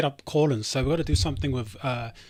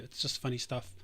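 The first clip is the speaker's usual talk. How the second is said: usual speaking voice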